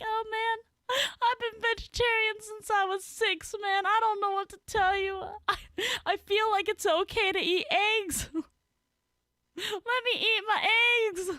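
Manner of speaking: very high, mock-tearful whine; hammed-up whimpering protest; laughing plea. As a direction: plead in a squeak, choked with laughter. Retold plea to vegans, cracking up.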